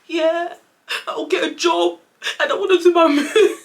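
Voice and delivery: high, loud blubbering wail breaking into laughter; hammed-up sobbing promise; mock crying. Daughter telling dad her plan, in exaggerated tears. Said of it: "really crying".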